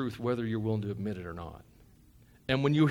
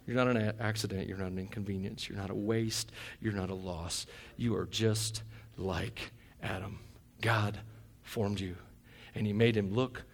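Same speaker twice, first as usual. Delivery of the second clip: much softer and lower, gentle; tender reassurance; 6 semitones lower, 9 dB quieter than usual. Real, not acted. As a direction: reassure each listener quietly. Sudden soft tender reassurance.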